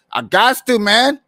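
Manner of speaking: loud, high burst of excitement; excited shout. Retold or imitated. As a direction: shout it out, fired up. Excited retold reaction.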